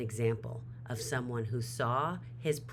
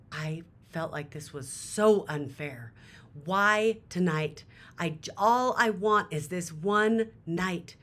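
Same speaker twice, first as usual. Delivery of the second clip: louder and higher, anguished protest; frustrated outburst; 5 semitones higher, 7 dB louder than usual. Retold or imitated. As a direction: cry out at the unfairness. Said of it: "he was so frustrated".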